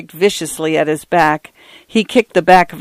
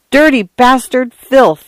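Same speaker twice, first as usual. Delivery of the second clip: furious cry; 7 semitones higher, slower than usual. A narrator's reading. Arthur striking the man, enraged cry. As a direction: hurl the words while striking. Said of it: "Arthur cried".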